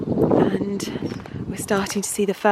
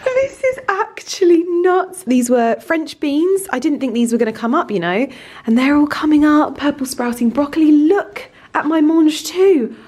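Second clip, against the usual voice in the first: louder, higher real delight; excited; 6 semitones higher, 4 dB louder than usual. Real, not acted. Showing viewers her greenhouse seedlings, loud real delight. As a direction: gush over each seedling.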